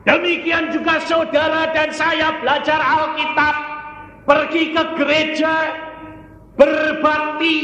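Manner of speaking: high, sustained pulpit delivery; full-force preaching. Real, not acted. Preacher applies it to the churchgoers, full force. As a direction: ring it out high over the congregation.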